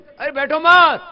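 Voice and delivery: loud, high, drawn-out call. Real, not acted. Yoga teacher stopping the class from sitting up, calling out. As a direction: call across the class, stretching it out.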